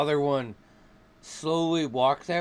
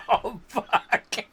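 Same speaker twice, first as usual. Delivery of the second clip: real high-pitched laughter around the curse; laughing; 6 semitones higher than usual. Real, not acted. Game master reacts to the roll, laughing hard. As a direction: swear while cracking up.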